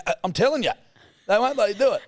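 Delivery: a put-on old bloke laying down the law; dad's stubborn insistence. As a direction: ham up dad's stubborn warning. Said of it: "He go".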